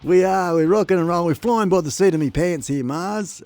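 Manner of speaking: loud and high, rocking out; hyped. Real, not acted. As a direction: ride the hype. Hyping stream.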